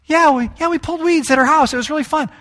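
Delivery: put-on high, bubbly kid sister. Sister telling her brother weeding was fun, high and bubbly. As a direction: gush about it in a high little-girl voice. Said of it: "It's like".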